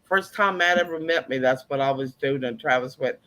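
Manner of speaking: ordinary talk, nothing marked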